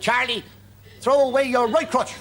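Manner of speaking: high, lilting Pope calling a name; mock Pope calling out, pitched high. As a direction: play the Pope summoning Charlie. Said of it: "he didn't have an Irish accent".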